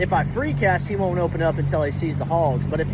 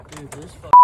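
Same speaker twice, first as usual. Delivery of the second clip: normal talking voice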